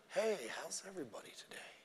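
whispery, very soft parody greeting; mock soothing preacher. Preacher greeting his church, mock soothing whisper. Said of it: "Men don't talk like that".

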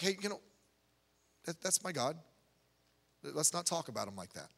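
quietly voicing a believer's gentle rebuke; soft reverent quote. Preacher quoting a gentle believer, softly.

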